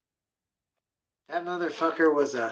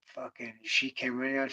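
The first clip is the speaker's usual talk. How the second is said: ordinary talk, nothing marked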